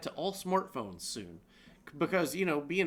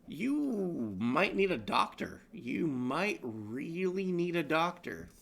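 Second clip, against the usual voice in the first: slow deadpan; slower than usual. Real, not acted.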